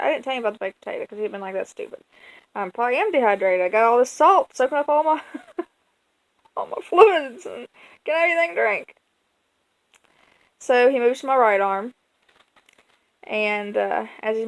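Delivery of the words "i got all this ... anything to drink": laughing through the words. Real, not acted. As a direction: chuckle while speaking. Laughing at own mistake.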